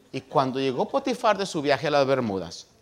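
ordinary talk, nothing marked

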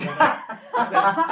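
laughing. Real, not acted. Laughing mid sentence.

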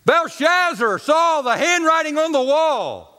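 a preacher reading Daniel; preacher's reading. Retold or imitated. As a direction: intone it from the pulpit. Pastor's sermon voiced.

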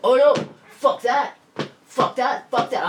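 high, loud outraged refusal; indignant denial. Retold or imitated. Boyfriend denying he is there for detox, loud and indignant. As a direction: reject it hotly.

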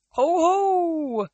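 high loud villain laugh ho ho; mocking chuckle. A narrator's reading. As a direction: laugh down at the captive goddess. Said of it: "Atlas chuckled".

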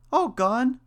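very high, loud mouse question; crying out, mouse. A narrator's reading. Mouse reacts to the third name, high loud cry. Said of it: "cried the mouse".

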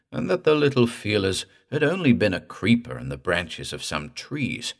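usual speaking voice

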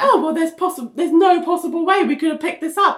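lightly mimicked doctor, pitched high; high, defensive imitation of an excuse; mock excuse-making. Mimicking doctors who never picked it up, high mock excuse.

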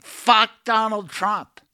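high, drawn-out mock holler; retold yell, not at full volume; imitated yell. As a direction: stretch it out like calling from a car. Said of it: "she yelled".

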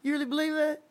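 high, loaded mock threat; menacing question. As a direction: give him one last chance to back down. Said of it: "He's like".

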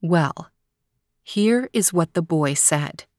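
ordinary delivery

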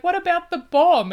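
high, loud imitated prompting; hammed-up loud, high-pitched question; urgent reminder. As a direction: blurt it out, acting the listener. Acted prompt in retold comic exchange.

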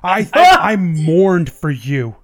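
hurt, rising accusation; betrayed lover rising in outrage; angry. Acted. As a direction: scold him, building to a near shout. Lady of Knives confronts lost love.

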